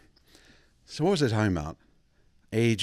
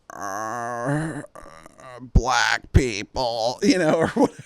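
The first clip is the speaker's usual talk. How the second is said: dazed blurt; 3 semitones higher, 6 dB louder, much slower than usual. Retold or imitated. Dazed old man blurting.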